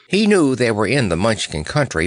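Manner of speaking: plain reading voice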